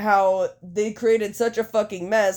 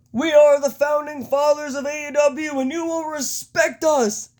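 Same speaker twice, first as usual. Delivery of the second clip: high, emphatic wrestling promo voice; Nick Jackson; 8 semitones higher, 3 dB louder than usual. Acted. Wrestler demands respect from the crowd, mock-grand Nick Jackson impersonation. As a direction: proclaim it high, stretching out the final demand. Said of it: "That's my Nick Jackson impersonation".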